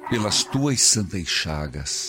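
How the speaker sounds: prayer leading. Acted. Priest leads repeat-after-me prayer. (oração lenta e pausada)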